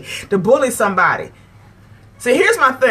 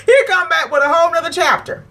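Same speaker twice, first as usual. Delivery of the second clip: higher, laughing disbelief; 7 semitones higher, faster than usual. Real, not acted. Laughing at retold argument.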